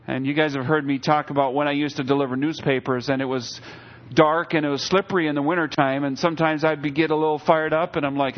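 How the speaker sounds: ordinary talk, nothing marked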